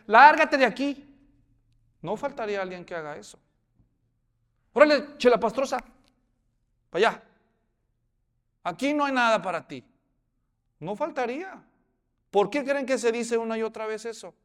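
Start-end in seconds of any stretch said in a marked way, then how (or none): 0.1-1.1 loud, high shout, drawn out; acted harsh order, stretched and loud; angry dismissal. Acts someone driving gleaner away. 4.7-9.8 loud, raised acted scolding; rough, loud chasing-away; scornful dismissal. Acts someone chasing gleaner away.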